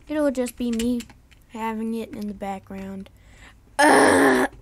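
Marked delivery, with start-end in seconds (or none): 3.8-4.5 frustrated loud ugh; loud groan. Real, not acted. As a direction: let out a sharp groan. Groan at game mishap.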